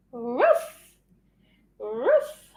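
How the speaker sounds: short woofs, each sliding upward; rising dog-bark imitation. Shown as a demonstration. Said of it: "What does the dog say?".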